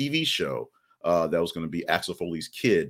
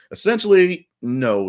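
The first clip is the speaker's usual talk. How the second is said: higher, louder, emphatic denial; 10 semitones higher, 8 dB louder than usual. Real, not acted. Host correcting the [name] rumor, loud and emphatic. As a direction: shut the rumor down hard.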